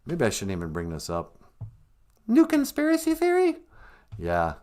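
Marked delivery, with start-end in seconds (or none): 2.3-3.6 high, loud squeaky puppet voice; squeaky mock voice. Sidekick teasing the host in a high, loud squeak.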